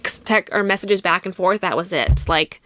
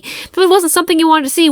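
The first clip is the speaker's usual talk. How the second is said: outraged; 8 semitones higher, 5 dB louder than usual. Retold or imitated. Outraged at his claim.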